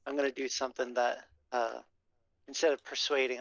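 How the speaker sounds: normal talking voice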